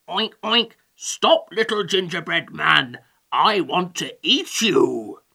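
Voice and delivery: oinking greedy pig character; oink, then demand it in a piggy voice. A narrator's reading. Hungry pig to the gingerbread man, piggy voice. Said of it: "said one pig".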